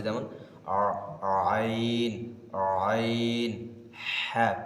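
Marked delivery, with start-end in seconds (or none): 1.5-3.5 আইন squeezed down in the throat, a bit louder; pressed ain. Shown as a demonstration. Teacher shows how আইন must be pressed. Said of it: "আইনটা একটু ডাবা-, ডাবাইতে হবে".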